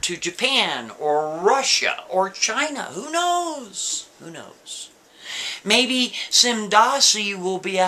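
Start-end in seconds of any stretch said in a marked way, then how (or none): 2.9-4.1 pitched way up, drawn-out and wondering; high exclaimed take. Shown as a demonstration. Storyteller musing aloud to his listeners, high and wondering. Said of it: "repeats the question two ways". 4.2-4.9 quiet murmured take. Shown as a demonstration. Storyteller answering himself, a soft throwaway murmur. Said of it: "repeats the question two ways".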